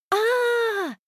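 long high delighted squeal. Acted. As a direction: squeal with joy. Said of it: "визжит подруга".

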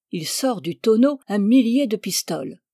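ordinary delivery, nothing marked

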